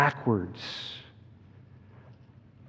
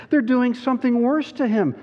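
put-on high, urgent girl's voice; acted distress, high-pitched; distressed Lucy; 11 semitones higher, 4 dB louder than usual. A narrator's reading. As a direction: voice a child character in alarm. Alarmed Lucy in the story.

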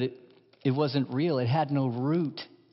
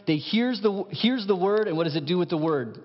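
ordinary talk, nothing marked

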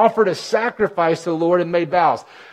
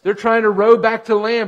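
normal talking voice

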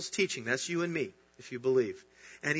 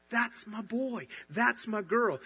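gentle proud father; 5 semitones higher, slower than usual. Retold or imitated. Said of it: "he looks at you and he says".

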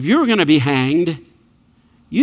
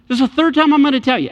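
higher, louder, fast exasperation; exasperated; 8 semitones higher, 4 dB louder, faster than usual. Acted. Jesus telling the disciples for the third time, exasperated. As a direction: spell it out, fed up with repeating.

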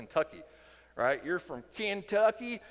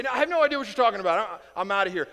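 fast, louder nervous brush-off; flustered denial; 5 semitones higher, 8 dB louder, much faster than usual. Retold or imitated. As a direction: rush it out, wanting to flee. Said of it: "rushes back to the gateway".

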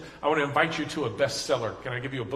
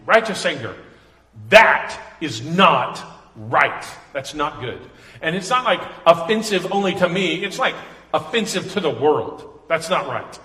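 louder, pressing sermon delivery; fiery preaching; 2 semitones higher, 8 dB louder than usual. Acted. Pastor explaining righteous anger to congregation, fiery and loud. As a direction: preach it with full force.

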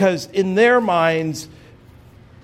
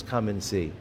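hushed reverent; 9 semitones lower, 10 dB quieter than usual. Shown as a demonstration. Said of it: "who hasn't heard these words".

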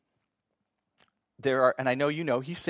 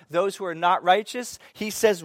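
louder, higher, building intensity; rising preaching fervor; 5 semitones higher, 7 dB louder than usual. Acted. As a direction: preach it like the verdict is coming.

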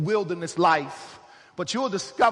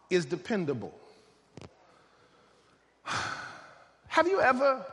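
ordinary talk, nothing marked

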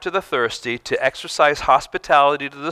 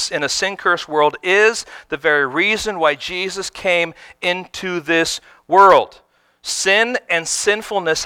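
higher, louder preaching, slowing on the last line; 5 semitones higher, 4 dB louder than usual. Real, not acted. Pastor drives home the sermon's point, raised preaching. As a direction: build the point to full force.

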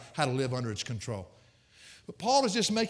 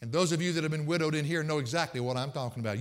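ordinary talk, nothing marked

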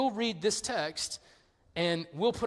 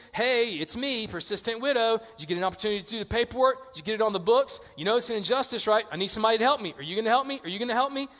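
badgering with rapid questions, pitched up a little; persistent widow; 3 semitones higher, faster than usual. Widow waiting by the judge's car, eagerly pestering. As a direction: nag him without letting up. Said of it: "It's the lady again".

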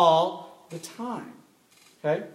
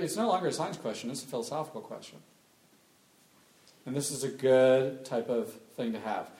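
ordinary talk

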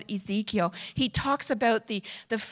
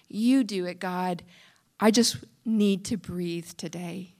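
hushed prayer; 4 dB quieter than usual. Acted. Quiet surrendering prayer.

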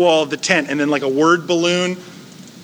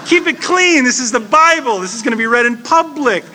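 high, loud mock-scandalized voice; playfully scandalized, keep it clean; mock prudish scold; 6 semitones higher, 3 dB louder than usual. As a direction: clutch your pearls and scold. Prudish reader objects to Bible.

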